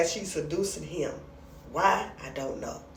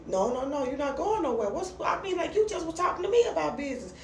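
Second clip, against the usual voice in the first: high-pitched objection, tumbling out; acted-out indignant objection; mock protest; 9 semitones higher, faster than usual. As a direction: stop someone leaving with an incredulous protest. Acted protest stopping him leaving.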